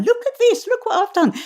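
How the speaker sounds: very high, excited mock boast; hammed-up delight at own handiwork; proud showing off. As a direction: squeal it like a proud child, playfully. Really proud, sending it off to people.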